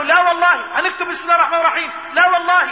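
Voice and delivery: high, emphatic retold outcry; outraged refusal. Companions who cannot bear the terms, outraged refusal. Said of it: "مش، مش قادرين يتحملوا".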